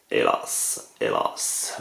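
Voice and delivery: hélas breathed out in a mock-tragic whisper; reading the card name as stage lament, whispered; theatrical whisper. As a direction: sigh it like a tragic actor.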